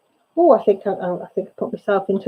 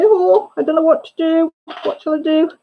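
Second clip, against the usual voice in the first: high, lightly whiny asking for help; mock helpless plea; 8 semitones higher than usual. Asking the group what to do, playfully helpless and high. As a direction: act lost and needy, tongue in cheek. Her own plea for advice, voiced.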